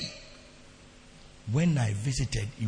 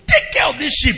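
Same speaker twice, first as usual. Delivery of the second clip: louder command; firm instruction; 8 semitones higher, 6 dB louder than usual. Retold or imitated. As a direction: hand the sheep over with authority. Handing over a sheep.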